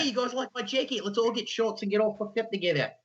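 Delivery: put-on Australian accent. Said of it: "I was like".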